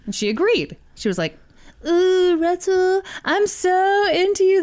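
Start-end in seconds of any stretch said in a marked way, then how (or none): 1.9-4.6 high, breathy mock seduction; hammed-up flirting; sexy voice. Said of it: "This is my sexy voice".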